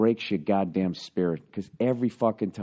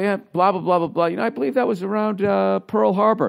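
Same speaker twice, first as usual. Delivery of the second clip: mock setup; 10 semitones higher, 5 dB louder than usual. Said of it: "I was going".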